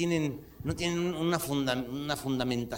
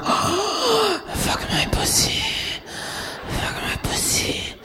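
high moan sinking into slow whisper; breathless whispered mock moaning in English; porn actress; 4 dB louder, much slower than usual. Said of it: "Y la vieja no decía". (gemido fingido y susurro lento)

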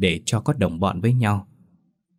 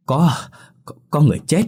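gasping, high and loud stammer; panicked stammering announcement; breathless panic; 7 semitones higher, 5 dB louder than usual. A narrator's reading. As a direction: blurt it out out of breath and scared. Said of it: "Bà ấy thở lấy thở để".

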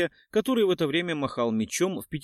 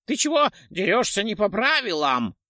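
high, louder indignant squeal; squealing ghoul; 5 semitones higher, 4 dB louder than usual. A narrator's reading. Ghoul squeals at the witcher, outraged and high. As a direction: shriek about foul play. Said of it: "завизжал поганец".

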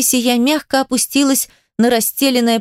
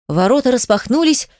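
plain reading voice

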